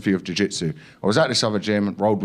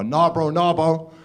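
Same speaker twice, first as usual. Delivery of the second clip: higher London roadman swagger; hammed-up gym-bro denial; 7 semitones higher than usual. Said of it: "say something that every gym goer wants to say".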